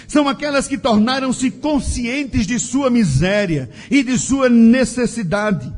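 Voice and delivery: normal talking voice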